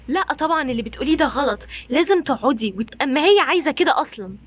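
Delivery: ordinary delivery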